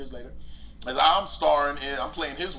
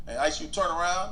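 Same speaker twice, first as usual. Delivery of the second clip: ordinary talk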